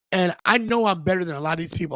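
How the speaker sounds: ordinary talk, nothing marked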